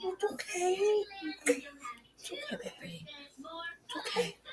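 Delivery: soft high whispered comfort; soothing whisper. Real, not acted. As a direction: hush the hurt little one.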